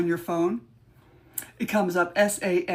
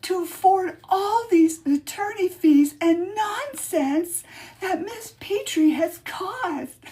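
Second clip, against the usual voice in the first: much higher, hammed-up witch; mock witch voice; 10 semitones higher than usual. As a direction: melodramatic witch, pitched high. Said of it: "I need an Oscar".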